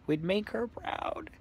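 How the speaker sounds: breaking whisper. Real, not acted. Choked up talking about her mother.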